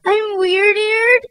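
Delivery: high, slow hurt wail. Acted. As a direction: drag out the hurt question. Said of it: "wailed Fragility".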